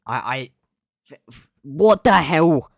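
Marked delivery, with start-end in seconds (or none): 1.1-2.7 slow, loud, high sputtering outrage; stammering outrage. Real, not acted. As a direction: sputter it out in shock.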